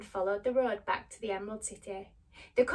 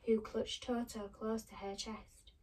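plain reading voice

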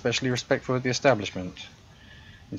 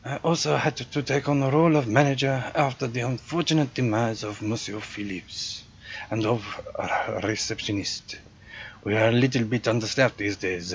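put-on generic European accent; 2 semitones higher than usual. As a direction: play the proud hotel concierge with a thick generic European accent. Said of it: "my generic European accent".

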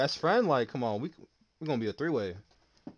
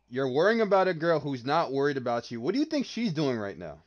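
normal talking voice

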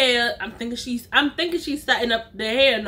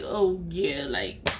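low muttered aside; 5 semitones lower, 6 dB quieter than usual. Retold or imitated. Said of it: "so I'm like".

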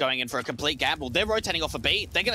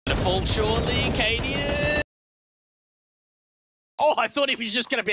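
excited; 8 semitones higher, 4 dB louder than usual. Real, not acted. Caster reacts to a play.

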